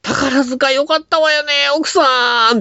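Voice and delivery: high, drawn-out caricature of a chatty lady; mock housewife. Housewife gushing to her friend about Takarazuka, high hammed-up voice. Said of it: "ってなかなか言わんで".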